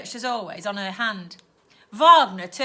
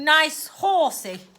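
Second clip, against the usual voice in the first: mock old man; 5 semitones higher, 6 dB louder, much slower than usual.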